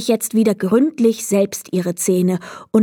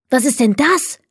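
excited; 4 semitones higher than usual. A narrator's reading. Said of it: "rief sie aufgeregt".

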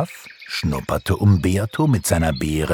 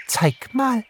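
tiny, very high bird voice; chirping little swallow. A narrator's reading. Little swallow wants to see snow figures, chirping. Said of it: "zwitscherte eine kleine Schwalbe".

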